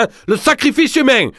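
très aigu, sacrifice appuyé puis chute; chaviré. Acted. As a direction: crie sacrifice dans un vertige. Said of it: "chaviré". (voix chavirée, très aiguë)

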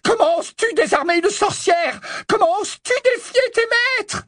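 very high furious witch screech; high, enraged shriek; bawling insult. A narrator's reading. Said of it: "brailla Bellatrix".

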